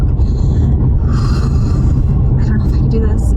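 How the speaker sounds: sighing, half-voiced, slow and breathy from panic; barely getting the words out; breathless panic. Real, not acted. Woman hyperventilating before her vaccine, breathless and panicked. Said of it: "I can barely talk".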